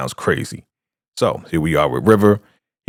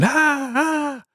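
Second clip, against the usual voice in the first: thin, very high drawn-out scream; high mock scream. Man on fire, high-pitched imitated screaming.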